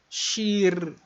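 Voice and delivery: shir com i curto. Shown as a demonstration. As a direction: pronounce shir with a short i. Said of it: "cântico". (pronúncia de shir)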